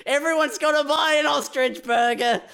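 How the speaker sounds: high, eager sales voice; mock salesman. Staff member pushing ostrich as future meat, high and eager. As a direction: sell it like it's the future.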